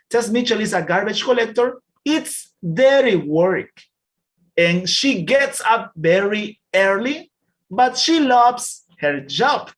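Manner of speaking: slow, clear reading of a class text; slow reading aloud. Shown as a demonstration. Teacher reading a text slowly to the class. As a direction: read each word slowly for learners.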